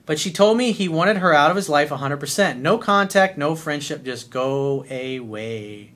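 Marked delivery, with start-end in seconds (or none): none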